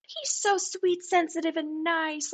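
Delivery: high, sugary mock gushing; milking it. Mimicking Kathy milking Jerry's comfort, mock gushing. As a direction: lay the sweetness on, pitched high. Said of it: "she's just milking it".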